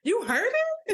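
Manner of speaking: high, rising astonished retelling; re-enacted shock, pitch shooting up; shocked disbelief. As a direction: squeal it in happy disbelief. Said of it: "it was just the shocking of".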